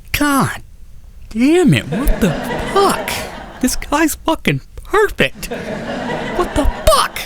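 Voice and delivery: high, slow, laughing squeak of disbelief; cracking up in exasperated disbelief; incredulous laugh breaking through the words. Real, not acted. Disbelief at perfect boyfriend.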